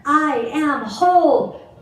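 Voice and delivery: affirmation rising into a loud, slow, excited declaration; too excited. Shown as a demonstration. Yoga teacher gets carried away reciting the affirmation, loud and excited. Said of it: "I got really too excited on that one".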